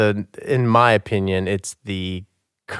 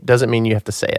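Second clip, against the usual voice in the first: usual speaking voice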